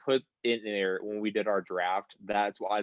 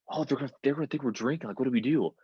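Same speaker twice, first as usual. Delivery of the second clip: higher-pitched, rushed retold panic; stressing out; 4 semitones higher, faster than usual. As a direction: imitate a friend starting to stress out. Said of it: "this kid starts stressing out".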